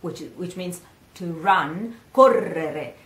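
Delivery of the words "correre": "Correre" loud, with a long rolled RR; double R. Shown as a demonstration. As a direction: roll the middle R long in "Correre". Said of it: "it's a double R".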